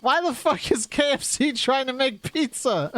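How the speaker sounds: high-pitched retold disbelief; incredulous outburst. Retelling his reply to a friend's news, incredulous.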